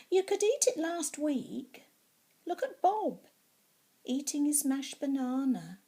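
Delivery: a mild hint of a coaxing mother; voicing Mummy. Mummy voiced in story.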